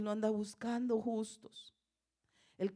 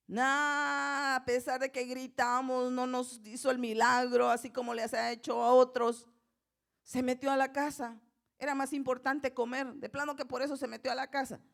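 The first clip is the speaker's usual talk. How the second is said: higher, louder griping voice; mock grumbling complaint; 5 semitones higher, 6 dB louder than usual. Blind men supposedly griping about being ignored, mock loud grumble. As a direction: complain loudly, imitating disgruntled supplicants. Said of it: "Pero ellos no dijeron".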